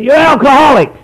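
loud, high blunt accusation; blunt old-timer. Retold or imitated. Old-timer confronting the newcomer, loud blunt accusation. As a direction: say it right in his face. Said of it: "he went".

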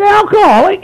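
high, loud incredulous question; stunned disbelief. Retold or imitated. Newcomer hearing the word, loud shocked question. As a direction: echo it back in disbelief. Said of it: "I said".

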